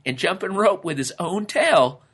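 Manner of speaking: high-voiced storyteller relishing the tale; storytelling lizard character. A narrator's reading. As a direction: tell the tall tale in a high, lively character voice.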